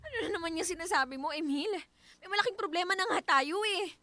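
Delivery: normal talking voice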